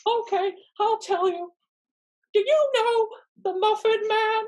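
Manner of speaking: high, loud, mocking squeak; squeaky gingerbread man. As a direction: tease him in a tiny cookie voice. Gingerbread man taunting Farquaad.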